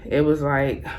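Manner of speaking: ordinary talk, nothing marked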